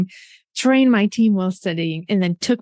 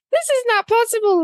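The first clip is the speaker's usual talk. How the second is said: put-on teen protest. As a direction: wail it like a worn-out teen who is done moving. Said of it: "I was like".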